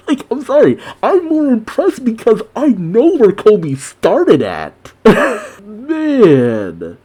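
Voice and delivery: high-pitched gushing with laughter; gush, thoroughly impressed and laughing; giddy admiration. Real, not acted. Gushing about being impressed.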